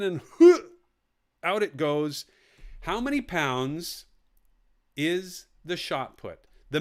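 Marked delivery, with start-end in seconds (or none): 0.4-2.2 laughing. Real, not acted. Miming a shot put throw.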